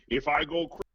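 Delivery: really shitty movie-star impression; lightly put-on Connery voice; Sean Connery. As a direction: start a deliberately bad Scottish impression. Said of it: "really shitty Sean Connery impression".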